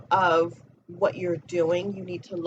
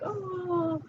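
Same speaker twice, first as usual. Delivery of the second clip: high, breathy-free gasp of delight; imitating someone melting at a cute puppy; mock swoon "oh"; 12 semitones higher, 5 dB quieter than usual. Viewer seeing something cute, a high delighted oh. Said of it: "you're like".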